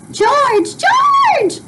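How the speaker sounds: very high frantic calling; mother screaming for her husband in a panic; frantic cry. Acted. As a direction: scream for George down the hall. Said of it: "she shouted".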